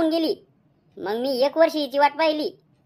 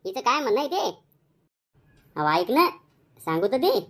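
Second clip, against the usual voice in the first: ordinary talk, nothing marked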